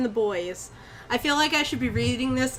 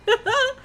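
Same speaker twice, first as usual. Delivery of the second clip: short, high, loud laugh; real laughter; 13 semitones higher, 4 dB louder than usual. Real, not acted. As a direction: let out a quick high laugh. Laughs at own joke.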